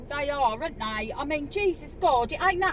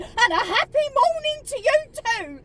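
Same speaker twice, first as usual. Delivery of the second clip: high, loud sarcastic jibe; sarcastic comeback to the McDonald's server; sarcastic retort; 10 semitones higher, 6 dB louder than usual. Retold or imitated. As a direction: throw the greeting back with scorn. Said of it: "And I went".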